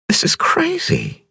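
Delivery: high, slow woman's voice, amazed; dazed wonder at meeting him; young woman Ping. A narrator's reading. Ping to Cavalier after the crash, high slow amazed voice.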